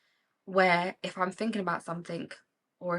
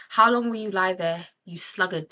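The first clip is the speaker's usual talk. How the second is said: reading scripture; 8 dB louder than usual. Shown as a demonstration. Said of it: "the Bible says".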